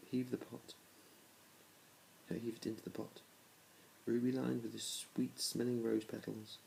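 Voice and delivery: ordinary delivery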